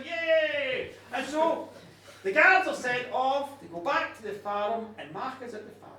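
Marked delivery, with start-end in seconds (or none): 0.0-0.9 high, drawn-out little cheer; crowd cheer. A narrator's reading. Happy crowd awaiting a spectacle, cheering. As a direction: cheer like a delighted onlooker. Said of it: "they're all kind of cheering".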